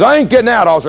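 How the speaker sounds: high, slurred refusal; a drunk driver digging in; drunk driver's defiance. Retold or imitated. Drunk driver refuses the cop, high and slurred. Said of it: "He says".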